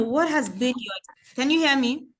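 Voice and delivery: normal talking voice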